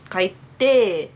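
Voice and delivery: ordinary talk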